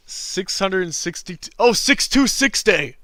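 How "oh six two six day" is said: sudden delighted realization. Real, not acted.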